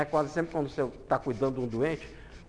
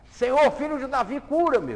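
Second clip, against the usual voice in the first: high, loud pleading shout; blind man crying out; 8 semitones higher, 9 dB louder than usual. Acted. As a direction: cry out to be healed. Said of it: "O cego começou a gritar".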